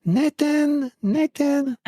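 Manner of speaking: high, lilting French call of a name; imitating a French woman calling across the platform. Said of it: "in this beautiful French voice".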